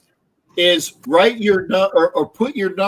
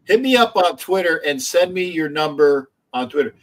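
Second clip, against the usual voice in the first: ordinary talk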